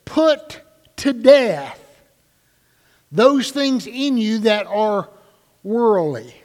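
preacher repeating the verse for weight; grave emphatic reading. Shown as a demonstration. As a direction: hammer the command slowly. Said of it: "as grave and more emphatic".

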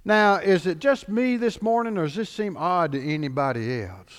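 usual speaking voice

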